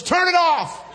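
hammed-up high-pitched furious order; angry yell. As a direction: imitate a fed-up wife yelling, high and loud. Wife snaps at him during labor.

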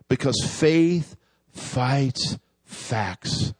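drawn out, weighted words; very slow emphatic. Acted. Preacher repeating the theme, very slow and weighted. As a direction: stretch the phrase way out. Sermon key phrase, slower.

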